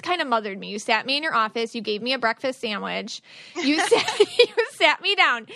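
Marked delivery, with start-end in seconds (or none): 3.5-5.5 laughing through the words, higher and louder. Real, not acted. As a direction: giggle it out, slowed down. Laughing while retelling the kindness.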